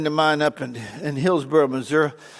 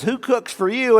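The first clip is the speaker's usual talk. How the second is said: owl hoot; 7 semitones higher than usual. Retold or imitated. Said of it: "The Bart Al says".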